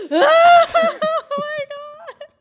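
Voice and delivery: gasping, slow, very high and loud, a hammed-up sob; mock sob. Alone in the locker room after the cane shot, a hammed-up wailing sob. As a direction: act out finally falling apart in private.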